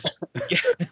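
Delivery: high breathy giggle; excited laugh. Real, not acted. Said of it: "You're excited".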